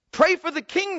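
high, loud mock command; cried out. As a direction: bark the order up at her like a soldier. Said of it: "they cried to her".

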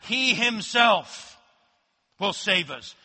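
slow down and lean on He himself; emphatic He himself. Shown as a demonstration. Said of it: "the he there is emphatic".

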